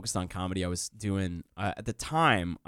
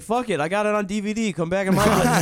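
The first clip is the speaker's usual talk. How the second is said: excited; 9 semitones higher, 5 dB louder than usual. Retold or imitated. Retold invitation to stranger.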